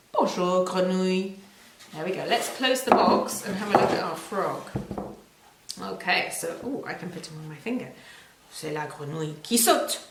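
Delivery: normal talking voice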